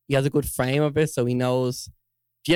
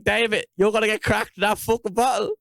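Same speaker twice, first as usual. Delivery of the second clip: warning mate; 6 semitones higher than usual. Retold or imitated. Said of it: "I'm like".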